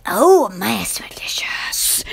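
breathy whispered quote; an unvoiced, hushed whisper. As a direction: hush it like a secret treat. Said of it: "I lost my smell when I go".

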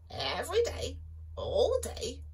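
high clucky hen voice; clucking chicken. Acted. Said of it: "she clucked".

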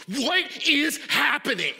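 exasperated email voice; hot and bothered. Retold or imitated. Angry email sender demanding answers, exasperated and loud. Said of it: "someone who's, like, hot and bothered".

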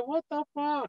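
high, startled outcry; shocked outburst. Retold or imitated. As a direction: blurt it out in sudden shock. Waking with tube down throat.

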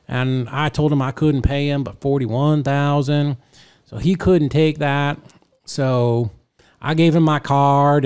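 put-on loud, very slow, marble-mouthed old-timer; Bob. Old man telling the room about his house deal, slow loud drawl. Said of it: "and slow".

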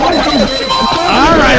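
a drawn-out yell over the line; loud drawn-out all right. Real, not acted. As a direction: bellow it out long to cut the noise. Host yells at noisy conference line.

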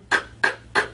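"K, k, k" as bare voiceless k bursts with no vowel; "K, k, k" whispered as pure k sounds. Shown as a demonstration. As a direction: clip out "K, k, k" as three soft puffs of k. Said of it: "Can you make that sound?".

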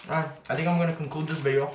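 chewing while speaking; talking around a bite; mouth full of salad. Real, not acted. Seen 2: Bite taken at -2; still chewing, mouth working, forking salad.

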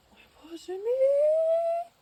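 long high-pitched squeal of delight. Real, not acted. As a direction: squeal with joy, drawn out slowly. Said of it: "тільки не верещи". (захоплений вереск, протяжно і високо)

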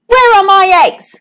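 high, loud demand from a cross king; bad-tempered demand. A narrator's reading. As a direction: bellow it crossly at an underling. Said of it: "in a bad mood".

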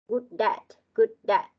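"Good at" run together as "goo-dat"; linked sounds. Shown as a demonstration. Teacher showing pupils liaison, linked "Good at". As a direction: link the d of "Good" into "at". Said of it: "chúng ta sẽ có thể là nối âm".

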